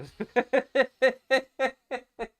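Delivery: loud high cackling laugh, like a cartoon dog; high cackle. Real, not acted. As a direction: cackle helplessly. Laughing at the email.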